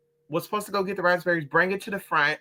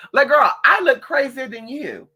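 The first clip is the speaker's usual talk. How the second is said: sassy mock retort; 6 semitones higher, 6 dB louder than usual. Mocking the staff's looks.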